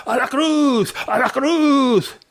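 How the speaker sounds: high, slow shouted chant; bay for the cross like an angry crowd; mob shouting. Acted. Nobles shouting at Pilate for crucifixion, high slow yell. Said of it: "se pusieron a gritar".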